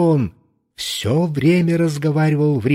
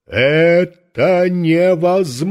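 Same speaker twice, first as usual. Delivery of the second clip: booming, slow and higher; громовой голос; 5 semitones higher, 5 dB louder, slower than usual. A narrator's reading. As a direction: thunder the words out like a giant. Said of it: "раздался громовой голос". (громовой голос)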